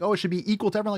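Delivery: high, airy mock of a naive idealist. Mocking utopia believers in a high sneering voice. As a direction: sneer it out in a sing-song pitch up high. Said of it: "It's like".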